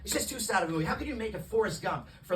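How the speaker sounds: ordinary talk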